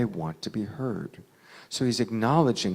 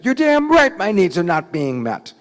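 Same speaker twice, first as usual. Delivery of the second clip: loud, high furious retort; very angry; 9 semitones higher, 13 dB louder than usual. Retold or imitated. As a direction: shout back at the speaker in anger. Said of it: "you're very angry".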